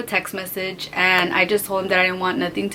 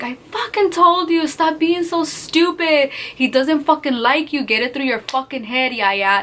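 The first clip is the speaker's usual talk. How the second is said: cousin yelling; 5 semitones higher than usual. Retold or imitated. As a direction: scold her like a furious crying cousin. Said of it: "she was crying and she's like".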